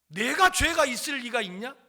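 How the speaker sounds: imitated scolding, high and loud; indignant mother's retort. Mother answering her son about sin, indignant retort. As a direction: throw it back as an indignant, mock-scolding question.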